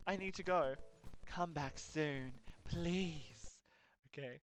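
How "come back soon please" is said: slow, drawn-out eerie plea; creepy plea. Acted. Game character begging the player to return, slow and eerie. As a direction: stretch each word like a lonely ghost. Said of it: "Look, that's scary".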